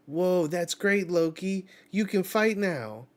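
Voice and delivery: high, loud, thrilled Luffy voice; bright boyish shout of delight; excited cheer. Acted. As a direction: cheer him on at the top of your voice.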